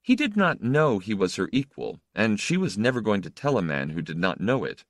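plain reading voice

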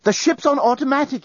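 high, agitated report; a touch of breathless urgency; panting. A narrator's reading. Journalist bursting onto the captain's bridge, panting. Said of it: "he panted".

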